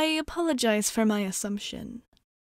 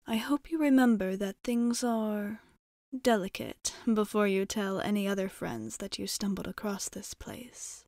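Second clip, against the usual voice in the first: usual speaking voice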